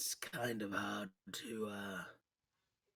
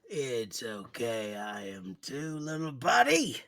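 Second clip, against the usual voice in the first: comforting; 8 dB louder, slower than usual. Acted. Comforting the mole, slow.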